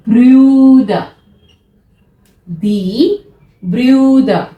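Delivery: Brüder with the ü umlaut stressed; umlaut vowel. Shown as a demonstration. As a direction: say Brüder with a rounded ü.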